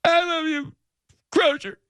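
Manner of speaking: high, hammed-up sobbing goodbye; fake-sob the farewell up high; mock crying. Host parodying the crying sendoff, high mock sobbing. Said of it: "How would it sound if you did do one".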